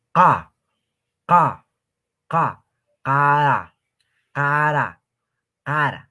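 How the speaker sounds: "K" y "cara" con la k reventada, fuerte; k a boca abierta. Shown as a demonstration. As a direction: abre toda la boca y golpea la k de "cara". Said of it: "abran toda su boca". ("cara" con k eyectiva y boca bien abierta)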